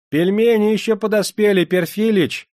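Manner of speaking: high, loud cook's holler; high-pitched shouted announcement; calling out. Acted. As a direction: call through a door. Cook calls through the door.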